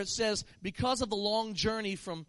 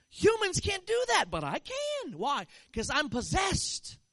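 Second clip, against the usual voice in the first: boasting possessed man; 9 semitones higher, 4 dB louder, slower than usual. Retold or imitated. Possessed African boasts of power.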